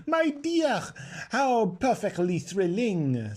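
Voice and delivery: high, slow gush; thrilled society girl gushing; breathless, drawn-out excitement; gushing delight. A narrator's reading. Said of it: "said Lazreline".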